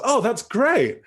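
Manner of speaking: mock delight with a big pitch swoop. Shown as a demonstration. Reacting inappropriately to a friend's breakup, delighted. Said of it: "what is the different meaning being expressed".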